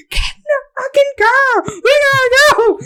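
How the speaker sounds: high, stretched-out urgent yell; screaming. Retold or imitated. Screaming at the girl to leave, frantic and high. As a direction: re-enact a frantic getaway scream. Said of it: "He heard me screaming at this girl".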